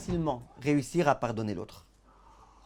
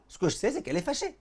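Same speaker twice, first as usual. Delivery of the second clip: pitched up and sure of himself; insist, certain she's angry; insistent student; 8 semitones higher than usual. Acted. Husband tells the rabbi his wife is angry, insistent.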